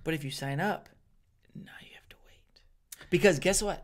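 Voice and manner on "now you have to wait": hushed whispered warning. Real, not acted. Warning a fellow comic about the wait, whispered. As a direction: whisper it like a secret. Dramatic aside.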